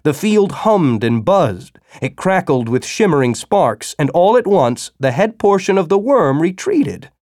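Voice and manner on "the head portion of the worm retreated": usual speaking voice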